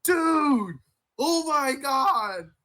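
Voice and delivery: high, drawn-out dramatic reading; mock shock. Reading out his wife's text about the shooting, mock shock. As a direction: stretch it out slowly in mock astonishment.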